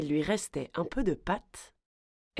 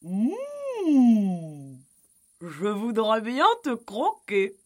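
loud, higher cow voice; mooing cow; 4 semitones higher, 4 dB louder, much slower than usual. A narrator's reading. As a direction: open with a long moo, stay bovine. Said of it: "meugla très fort".

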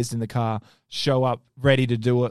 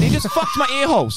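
high, raised-voice outburst; comic outrage; 13 semitones higher than usual. Real, not acted. Ranting to friends about the car talker, high comic outrage. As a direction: let it burst out high, indignant for laughs.

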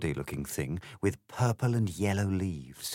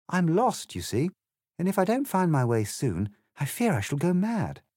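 lightly voiced Alice; 9 semitones higher than usual.

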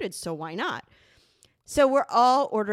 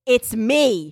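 loud, emphatic retold reveal; prank reveal; 3 semitones higher, 11 dB louder than usual. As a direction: belt out the punchline as the prankster.

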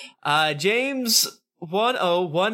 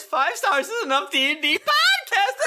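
1 hammed-up rave; faster than usual. Reading a fan review aloud.

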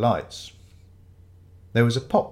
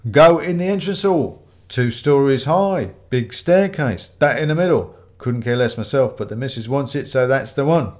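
louder, pushy customer holding forth; slightly raised voice; 2 semitones higher, 7 dB louder than usual. Acted. Ramage explains where the tree will go, in a raised voice. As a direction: raise the voice a little to boast. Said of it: "then raised his voice a little".

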